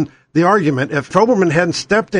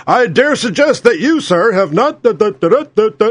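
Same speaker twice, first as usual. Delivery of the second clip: Keith Olbermann; 3 semitones higher than usual. Acted. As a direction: declaim like a self-important orator, dissolving into nonsense syllables. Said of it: "he talks like a pompous prick. When he's screaming at Bush".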